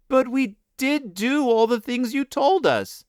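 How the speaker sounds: put-on children crying despairingly. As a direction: cry it out in despair. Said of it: "cried despairingly".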